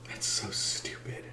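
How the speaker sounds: soft, slow whispered disgust; whispered aside. Real, not acted. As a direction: breathe it under your breath. Muttered aside.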